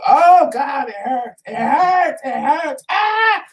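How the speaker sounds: dramatized high-pitched wailing, building to a shriek; hammed-up cries of pain; screaming in pain. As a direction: re-enact yourself screaming in agony. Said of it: "And I started screaming again".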